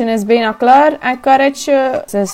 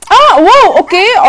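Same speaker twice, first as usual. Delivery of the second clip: high, loud startled wow; sudden surprise; surprised; 8 semitones higher, 5 dB louder, slower than usual. Real, not acted. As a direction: caught off guard by a guest. Surprised by a visitor.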